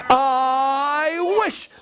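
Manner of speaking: long vowel swooping upward; building wish. Acted. Wife making a magic wish, drawn-out rising swoop. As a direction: slide up high and burst onto the last word.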